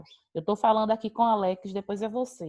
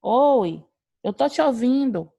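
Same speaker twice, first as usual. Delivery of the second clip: calling out; 3 semitones higher, 6 dB louder, slower than usual. Real, not acted. Teacher answering a student who can't hear her, calling out. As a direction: answer loudly over a bad line.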